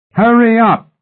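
loud, high parting shout; high, full-voiced yell; bellowing. A narrator's reading. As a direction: bellow after men who are leaving. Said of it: "Mallory bellowed as a parting shot".

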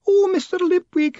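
high, loud, tearful wail; weeping woman clinging to Moist. A narrator's reading. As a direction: throw your grief at him. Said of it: "she wailed".

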